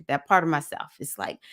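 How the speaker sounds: ordinary talk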